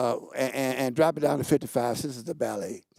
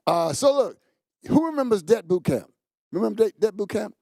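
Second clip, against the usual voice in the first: ordinary talk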